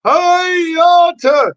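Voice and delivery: high shouted "Hiya"; shouted catchphrase. Real, not acted. Host shouting his catchphrase greeting to the chat. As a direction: belt out the greeting.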